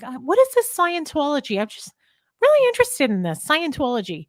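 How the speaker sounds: high innocent newcomer voice; fake curious newcomer. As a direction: play the clueless visitor. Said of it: "I need to go in and be like".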